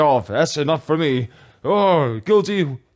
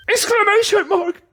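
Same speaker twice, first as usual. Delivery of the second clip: high, shouted reading; mock exclamation. Reading the game text aloud, mock shout. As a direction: belt out the punctuation.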